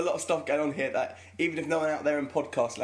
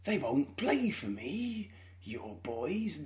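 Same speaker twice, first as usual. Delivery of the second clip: put-on slow Northern drawl, boys dropped much softer; drawn-out, pleading drunk Clough; Brian Clough impression; 5 dB quieter, much slower than usual. Repeating the Damned United line for Joel, slow Clough drawl. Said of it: "pretty accurate to how it sounds in the film".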